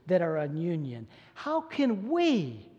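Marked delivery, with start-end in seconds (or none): 1.3-2.7 "How can we" high and slow, WE stretched out; emphatic we. Retold or imitated. Preacher including the congregation in Paul's question, emphatic we. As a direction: lean long and hard on WE. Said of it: "is the emphatic we".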